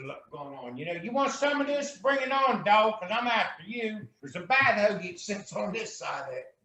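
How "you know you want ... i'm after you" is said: gangster persona. Pretend. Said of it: "you got the Gangster [name]".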